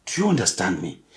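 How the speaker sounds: ordinary talk, nothing marked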